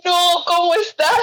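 high, drawn-out mock wail; retold panic. Asking Luis how he is after the quake, panicked. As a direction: plead anxiously, stretching the words high. Retells own panicked call.

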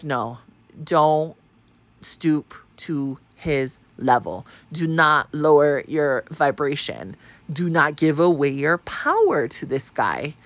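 slow, low, measured self-restraint; firm self-talk. Retold or imitated. Telling herself not to stoop to his level, slow, low and firm. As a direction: talk yourself down, one deliberate word at a time.

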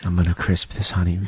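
low, hushed ASMR murmur. Pretend. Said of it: "getting into our ASMR voices".

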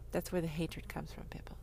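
breathy unvoiced whisper to the crowd. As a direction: lean in and whisper it. Whispered aside to audience.

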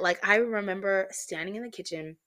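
ordinary delivery

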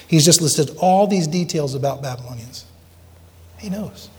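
ordinary talk

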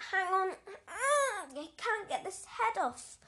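high, loud whiny fluster; panicked whine. Acted. Boy stuck inside the costume head whines in panic. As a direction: struggle and whine, stuck in the mask.

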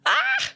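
very high excited "ah"; real swooning squeal; thrilled squeal. Real, not acted. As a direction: let out a high squeal of delight. Thrilled after reading passage.

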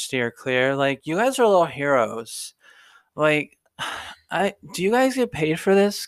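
put-on high, gushing drunk sorority girl; tipsy girl overflowing with thanks. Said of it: "And then the drunk girl is".

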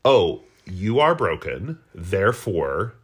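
ordinary talk, nothing marked

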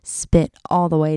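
ordinary talk

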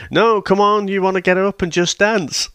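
high-pitched, insistent goading; mock coaxing. Retelling a character goading Freddy to dance, mock coaxing.

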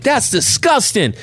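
high, drawn-out mock disgust; appalled nurse. Nurse seeing bedpans on her table, imitated high disgust.

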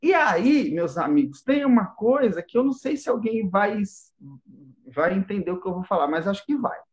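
ordinary talk

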